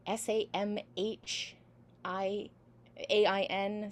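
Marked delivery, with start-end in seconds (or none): none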